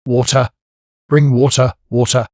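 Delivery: urgent calls. A narrator's reading. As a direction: plead loudly for water. Captain begging for water.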